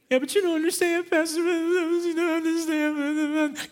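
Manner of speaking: high, whiny complaining voice; mock whiny protest to the pastor; whining churchgoer. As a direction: whine like a reluctant member of the flock. Whining congregant quoted by pastor.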